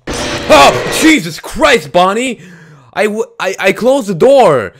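loud, high indignant shock; high, yelled protest; startled outburst. Real, not acted. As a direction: cry out in disbelief after a fright. Startled by Bonnie despite closed door.

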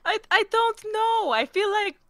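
high-pitched flustered stammer; acted awkward hesitation. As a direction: dodge the question in a squeaky, uneasy voice. Shy answer about private conversation.

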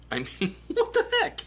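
high, laughing what the heck; laughing disbelief. Real, not acted. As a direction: giggle through it in disbelief. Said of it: "laughing disbelief".

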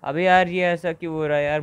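loud exasperated complaint; frustrated outburst. Real, not acted. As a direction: vent at the game. Frustrated at the game.

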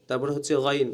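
usual speaking voice

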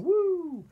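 high, loud, held hoot; swooping storybook ghost wail; ghostly woo. A narrator's reading. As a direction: imitate a whizzing ghost. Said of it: "It's whizzing about and saying".

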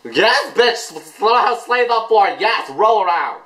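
high, whooping fan cries; thrilled high-pitched hollering; excited cheering. Real, not acted. Cheering on the music video.

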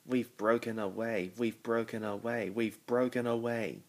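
ordinary delivery, nothing marked

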